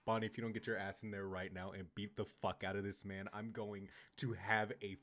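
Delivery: low threat. Acted. Threatening a character to act.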